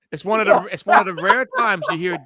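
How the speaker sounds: normal talking voice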